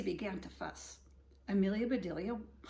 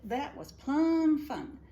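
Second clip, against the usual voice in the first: higher, slow and pleased; delighted drawl; 6 semitones higher, 7 dB louder, slower than usual. Acted. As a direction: savour the fun. Amelia delighted.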